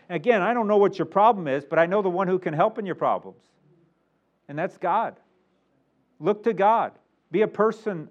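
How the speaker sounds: usual speaking voice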